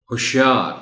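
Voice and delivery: ہوشیار squeezed to hush-yaar, short first vowel; shortened poetic form. Shown as a demonstration. Teacher modelling the poetic ہوشیار, short u at the start. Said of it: "مختصر شکل".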